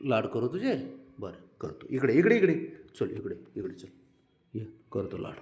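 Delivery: low, hushed coaxing; quiet, low-pitched invitation to come closer; soft coaxing. Real, not acted. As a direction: coax gently under your breath. Coaxing the pet to come for cuddles.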